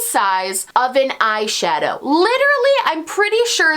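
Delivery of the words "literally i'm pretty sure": high, loud exasperated burst. Real, not acted. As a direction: burst out in disbelief. Outraged at tiny product.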